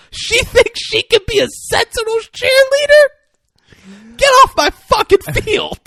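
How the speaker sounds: high, shrieking mock outrage; hammed-up indignant screech; outraged cheerleader. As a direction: escalate to a loud, squealed tirade. Acts out outraged head cheerleader.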